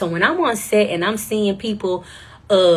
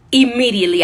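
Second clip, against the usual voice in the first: louder, punched out; emphatic; 5 semitones higher, 7 dB louder than usual. Real, not acted. Telling the audience how fast she took the job, louder. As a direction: land it hard and sure.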